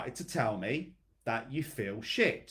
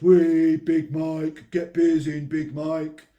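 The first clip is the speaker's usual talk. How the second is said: loud jeering mates, imitated; mocking lads; 3 semitones higher, 10 dB louder, slower than usual. Coach imitating mates jeering at Big Mike, loud. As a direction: jeer at him like a pub lad.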